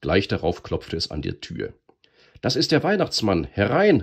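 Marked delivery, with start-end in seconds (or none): 2.4-4.0 put-on high, loud children's shout; excited shout. A narrator's reading. Human children calling Santa in, loud and high. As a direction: call out the door with joy. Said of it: "riefen die Menschenkinder".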